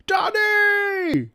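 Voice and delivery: very high, loud mock shout of Daddy; imitating the dog. Owner voicing his dog bursting through the door. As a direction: squeal it like an excited little girl. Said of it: "she'll go".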